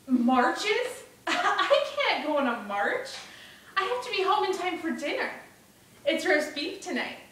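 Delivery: put-on loud, high boyish indignation; protesting young Bruno. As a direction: object loudly like an upset boy. Voices Bruno protesting the march.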